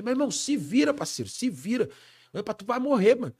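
high, loud threatening yell; angry shouted order. Retold or imitated. Boss shouting at the terrified singer to figure it out, loud angry yell. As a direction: yell it at him like a boss who means it.

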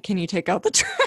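a blunt request breaking into a laugh; half-joking demand to a spouse, laughing; laughing mock nag. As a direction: giggle through the naggy ask. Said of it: "me just being like".

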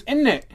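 "in it" run together as "innit"; British "innit". Retold or imitated. Host mimicking British speakers, "in it" as "innit". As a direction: say "in it" as one British word, "innit". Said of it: "And then it's always".

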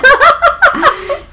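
high-pitched burst of laughter. Real, not acted. Laughs amid the bickering. (carcajada aguda)

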